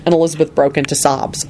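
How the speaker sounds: ordinary delivery, nothing marked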